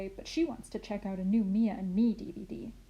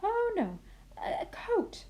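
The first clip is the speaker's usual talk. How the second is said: high "oh no" then whispered "a coat"; high startled self-correction; correcting herself; 12 semitones higher, slower than usual. A narrator's reading. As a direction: catch the slip in a high voice. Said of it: "Gigi corrects herself".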